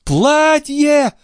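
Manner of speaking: very high, ecstatic cry; rapturous, high-pitched outburst; overjoyed exclamation. A narrator's reading. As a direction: nearly swoon with joy on the word. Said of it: "чуть не лишившись чувств от радости".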